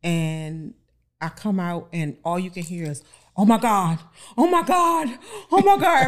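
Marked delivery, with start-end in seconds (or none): 3.4-6.1 rising, louder squeals of delight; excited shrieks. Retold or imitated. Friends screaming with joy at the bride's gown.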